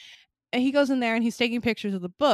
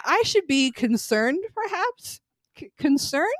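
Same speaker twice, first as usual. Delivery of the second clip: mock doubtful; 5 semitones higher, 4 dB louder than usual. Mock questioning own concern.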